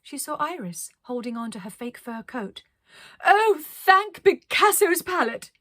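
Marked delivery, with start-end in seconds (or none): 3.2-5.5 high, loud, slow gush of relief; relieved cry. Acted. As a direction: overflow with relief. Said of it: "cried Arcadia".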